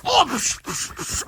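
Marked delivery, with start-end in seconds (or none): 0.1-0.3 pained cry. Acted. Villain hit, crying out.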